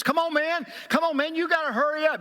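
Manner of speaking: high, pushy, exasperated plea; urgent impatience. Acted. Impatient believer pleading with Jesus to hurry, urgent.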